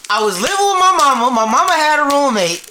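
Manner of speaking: high, loud, over-enunciated recap; exasperated re-explaining. Real, not acted. Retelling the roommate story to an interrupting friend, exasperated. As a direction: spell it out loudly for someone not listening.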